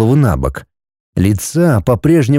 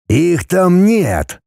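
higher, very slow rasp; hoarse stranger voice; 6 semitones higher, much slower than usual. A narrator's reading. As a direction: drag it out, knowing. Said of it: "услышал голос незнакомца".

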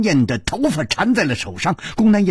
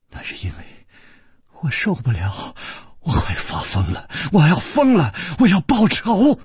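building desperation. Acted. Jealous woman vowing revenge to her lover, building desperation. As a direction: build from near whisper to frantic vow.

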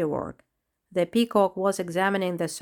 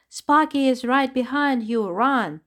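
higher, louder urgent call; urgent warning; 5 semitones higher, 4 dB louder than usual. Acted. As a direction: warn him to run. Warning friend of danger.

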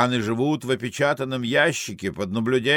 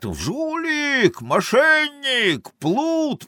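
high, drawn-out accusing cries; sailors' shouted abuse; 13 semitones higher, much slower than usual. Acted. Italian sailors shout at the cheat, high drawn-out cries. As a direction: hurl each word out high and long. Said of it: "Итальянские матросы кричат".

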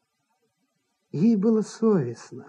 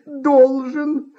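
exasperated woman character, higher pitch; agitated actress figure; 9 semitones higher, much slower than usual. A narrator's reading. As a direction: play an actress losing patience.

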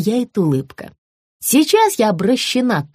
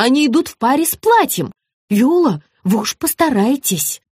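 higher, mildly flustered plea; nervous; 6 semitones higher than usual. A narrator's reading. Stylist begs Viola to keep shoes, mildly nervous. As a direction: plead with a touch of nerves. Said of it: "занервничала Регина".

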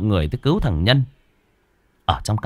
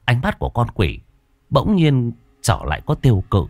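plain reading voice

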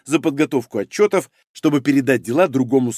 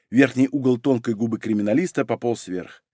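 ordinary delivery, nothing marked

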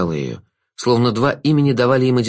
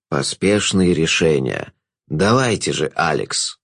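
low, slow villain's voice; low, needling trickster; taunting Jester; 7 semitones lower, slower than usual. A narrator's reading. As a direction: goad her in a low, drawn-out voice.

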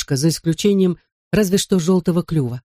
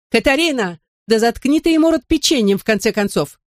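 high exasperated yell, much higher; angry shout; 7 semitones higher than usual. Acted. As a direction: snap at her sister over the noise. Sister shouting over the parrot.

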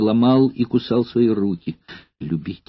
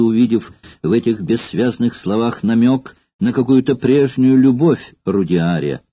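ordinary delivery

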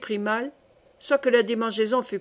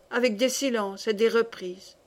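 usual speaking voice

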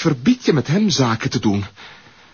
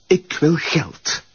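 cold threat; 3 semitones lower, slower than usual. Acted. Blackmailer threatens slowly.

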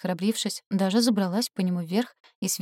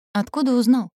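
quick, curious question, higher and louder; curious interrupting; 5 semitones higher, 6 dB louder than usual. A narrator's reading. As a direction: cut in eagerly. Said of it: "с любопытством перебила я".

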